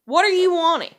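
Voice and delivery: loud, exasperated demand; total smart-ass. Retold or imitated. Woman acting out cornering a hinting man, smart-ass. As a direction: press the question hard and loudly. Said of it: "be a complete and total smartass".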